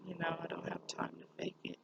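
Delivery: soft whispered repeat to herself. Real, not acted. Quietly to herself, a whispered promise. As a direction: breathe the words out, voiceless.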